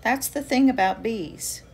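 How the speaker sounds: gentle, lively picture-book read-aloud; reading to children. Acted. Author reading her bee book to kids, gentle and lively.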